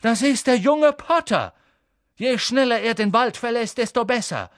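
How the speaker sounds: high, loud urgent reply; urgent insistence. A narrator's reading. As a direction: insist it is for Harry's sake. Firenze argues with Bane.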